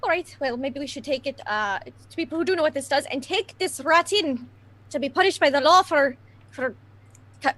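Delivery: ordinary talk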